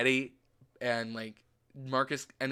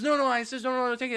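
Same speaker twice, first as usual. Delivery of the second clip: insisting seller; 10 semitones higher, faster than usual. Retold or imitated. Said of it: "He goes".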